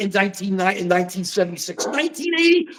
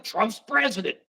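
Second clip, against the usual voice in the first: usual speaking voice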